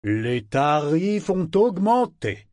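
mustache twirling villain. A narrator's reading. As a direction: cut him off like a smug villain. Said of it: "l'interrompit le ministre".

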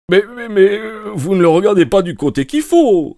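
indignant exclamation. A narrator's reading. Lestrade protesting Holmes reads the wrong side, indignant. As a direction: burst out in protest. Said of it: "s'exclama-t-il".

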